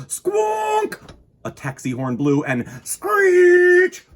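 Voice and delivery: slow, loud honk and screech; horn and brake sounds. A narrator's reading. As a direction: blare out the traffic noises. Imitating street traffic noises.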